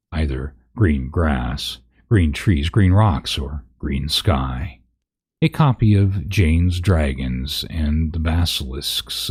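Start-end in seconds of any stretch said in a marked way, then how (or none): none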